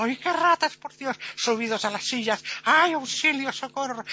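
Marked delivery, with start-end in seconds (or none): 0.0-1.2 high, hammed-up panic; mock shriek. Ladies screaming at imaginary rats, high mock shrieking. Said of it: "Y las señoras chillando". 2.6-4.0 high, theatrical cry for help; mock screaming. Women up on chairs yelling for help, high mock screams. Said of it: "pegando gritos las mujeres desmayadas".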